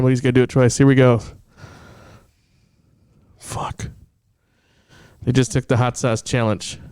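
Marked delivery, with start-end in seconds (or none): 3.4-3.9 whispered pained curse. Real, not acted. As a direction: breathe it out in pain. After hot sauce.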